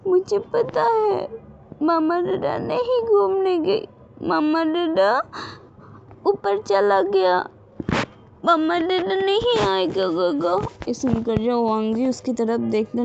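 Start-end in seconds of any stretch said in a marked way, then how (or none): 0.1-10.5 put-on high, tearful little girl's sobbing plea; crying child. A narrator's reading. Little Wing weeps to Gaga about her parents, high and tearful. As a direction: cry it out like a lost child. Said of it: "फिर से रोने लग जाती है".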